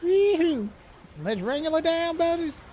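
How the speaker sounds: high, loud, whooping cowboy cheer; giddy high-pitched yeehaw to the team. Pretend. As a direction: yell it like a rodeo rider rounding up the herd. Cheering while fighting a game beast.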